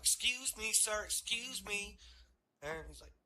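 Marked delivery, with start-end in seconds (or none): 0.0-2.0 high, slow polite call; slow, raised hailing voice. Retold or imitated. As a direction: call out to get his attention, drawn out. Cop calling out to driver.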